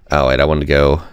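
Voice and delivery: ordinary talk, nothing marked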